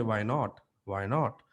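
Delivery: low, restrained "Why not?"; alternative low take. Shown as a demonstration. Coach showing another way to say it, low-key "Why not?". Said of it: "I can also say".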